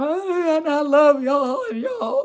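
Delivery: high cracking cry of love, slowly; words squeezed out through tears; choked-up thanks; voice breaking. Real, not acted.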